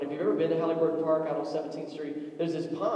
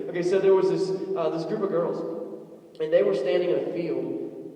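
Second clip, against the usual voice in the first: usual speaking voice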